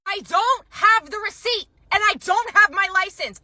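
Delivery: customer's high, outraged complaint; angry customer. Retold or imitated. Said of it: "how angry this woman was".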